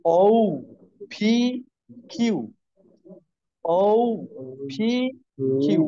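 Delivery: "ও", "পি", "কিউ" one at a time, with pauses; teacher drilling "ও", "পি", "কিউ", each set apart; pronunciation demo. Shown as a demonstration. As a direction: model "ও পি কিউ" letter by letter. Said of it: "এই উচ্চারণটা আমরা করব".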